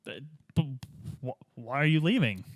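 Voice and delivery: usual speaking voice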